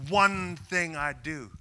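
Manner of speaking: "One thing I do." slow and firm, "One" stressed; all-in declaration. Shown as a demonstration. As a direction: say it like going all in. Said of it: "shove all the chips in".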